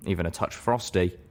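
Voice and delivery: usual speaking voice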